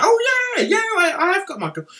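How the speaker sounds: high-pitched eager "oh yeah"; eager mock recognition. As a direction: jump in with high, keen agreement. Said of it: "I'd be like".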